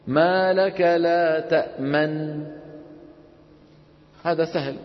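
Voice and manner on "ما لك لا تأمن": slow chant stopping at تأمن; ما لك لا تأمن held then cut; cut-off recitation. Shown as a demonstration. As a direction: break the verse off at تأمن to practise. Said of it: "الآن رح نقطعها".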